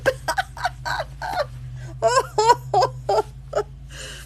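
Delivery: long high-pitched real laugh; helpless laughter she can't stop; high giggling fit. Real, not acted. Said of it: "Oh, I shouldn't laugh".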